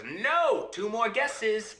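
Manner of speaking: high, slow imp voice; gloating imp. Acted. Imp turning down the princess's guess, high and gloating. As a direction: crow it high, stretching the words. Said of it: "And he laughs".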